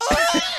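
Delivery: very high mock scream; imitated friend's shriek. Friend hit by vomit spray, high mimicked shriek. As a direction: squeal like you've just been sprayed. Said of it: "she's like".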